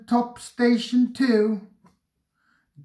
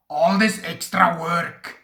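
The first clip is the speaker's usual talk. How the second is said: grumbling. A narrator's reading. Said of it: "he grumbled".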